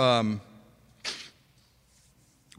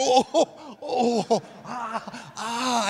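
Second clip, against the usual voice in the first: high, hammed-up cries of pain, oh and ah; mock pained moans; 8 semitones higher, much slower than usual. Husbands on the electrodes, imitated high pained moans. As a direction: play the men howling at fake labor pains. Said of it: "they're screaming like that there".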